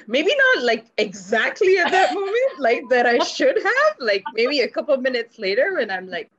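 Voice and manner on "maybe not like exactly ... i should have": higher and louder, laughing through the words; real amused laughter at herself. Real, not acted. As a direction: giggle as you admit it.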